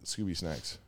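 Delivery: low, soft Scooby imitation; quiet echo of the Scooby voice; Scooby-Doo impression. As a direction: mutter it like the dog. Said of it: "Thank you, Raggy".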